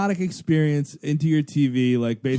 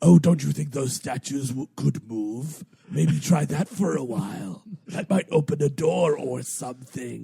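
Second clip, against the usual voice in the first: frustrated hint voice; 2 semitones lower than usual. Retold or imitated. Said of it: "getting frustrated with the player".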